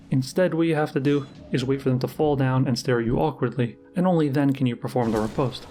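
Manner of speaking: usual speaking voice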